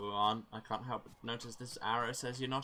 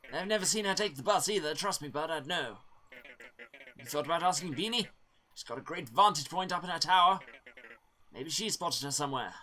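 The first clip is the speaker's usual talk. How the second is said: higher, louder, cheery driver voice; friendly bus driver NPC; 9 semitones higher, 5 dB louder than usual. Acted. Player voicing Oliver to himself, cheery townsman. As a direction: play the chatty helpful townsman.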